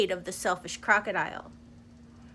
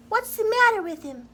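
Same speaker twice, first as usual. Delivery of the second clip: much higher gentle deer voice; 10 semitones higher, 4 dB louder than usual. Acted. Deer asking the others about the crocodile, higher voice. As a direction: wonder aloud as a small deer. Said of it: "asked a deer".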